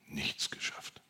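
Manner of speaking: breathy whisper of defeat; hushed echo of the line. Acted. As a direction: whisper it to yourself, deflated. Echoing his own failure, whispered.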